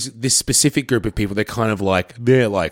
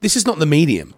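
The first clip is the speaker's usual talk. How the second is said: raised-pitch, emphatic scolding; exasperated rant; 8 semitones higher than usual. Retold or imitated. Host telling off friends who explain movies, exasperated rant. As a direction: scold them in a high, worked-up voice.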